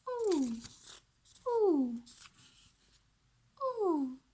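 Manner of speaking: high drawn-out "Ooh"; ooh sound demo. Shown as a demonstration. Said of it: "just like our sound".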